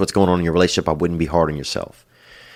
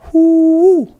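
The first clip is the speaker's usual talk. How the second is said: high, loud whoop of excitement; excited whoop; 11 dB louder than usual. Real, not acted. Host whoops at a caller from Colombia, shrieking loud. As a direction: let out a big shrieking woo.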